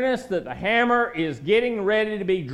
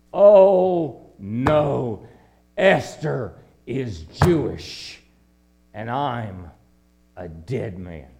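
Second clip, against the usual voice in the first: Haman's horror; 7 semitones lower, 5 dB louder, much slower than usual. Acted. Haman's horrified realization voiced.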